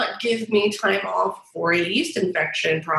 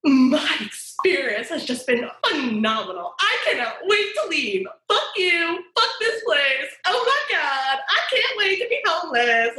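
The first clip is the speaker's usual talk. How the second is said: sarcastic outburst; 8 semitones higher than usual. Retold or imitated. At her exit interview, she gushes sarcastic praise in a high shouting burst. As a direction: gush about how much you want to leave. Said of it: "I'm like".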